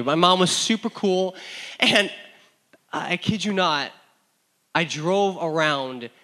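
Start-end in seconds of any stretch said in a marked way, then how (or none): none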